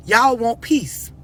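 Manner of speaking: ordinary delivery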